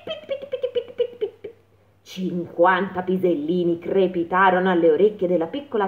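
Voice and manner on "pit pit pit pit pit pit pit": high soft pattering; pit pit sound. A narrator's reading. Droppings pattering on the grass, high. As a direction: patter it lightly like tiny pellets.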